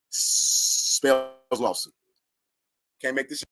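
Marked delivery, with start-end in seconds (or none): 0.1-1.9 very slowly, word by word; slow verdict. Real, not acted. Telling viewers the consequence, very slowly. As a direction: drag out the punchline.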